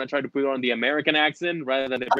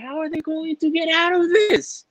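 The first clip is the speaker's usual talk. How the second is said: reliving the cliffhanger tension, pitched way up; anxious disbelief. Retold or imitated. Fan recalling the last issue, high anxious disbelief. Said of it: "I was like".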